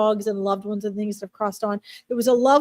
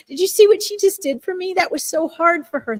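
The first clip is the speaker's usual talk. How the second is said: excited dog voice; 6 semitones higher than usual. Retold or imitated. Dog spirit praising owner.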